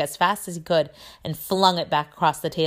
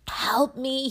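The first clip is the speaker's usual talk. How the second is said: high, drawn-out moan; moaning plea; 9 semitones higher than usual. A narrator's reading. Neville begging Hermione for help, moaning. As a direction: beg in a long, high whine. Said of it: "he moaned to Hermione".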